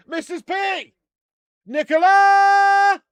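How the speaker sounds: high, loud, long-drawn call across the house. Real, not acted. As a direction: call to wife in the next room. Calling wife from another room.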